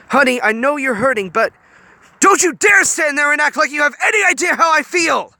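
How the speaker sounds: loud, high scream. A narrator's reading. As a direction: scream it at her in fury. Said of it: "Honey screamed".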